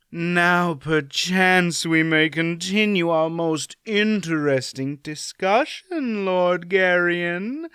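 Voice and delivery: slow, seductive purr; a countess cornering her prey; slow, honeyed purr. A narrator's reading. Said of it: "she purred at him".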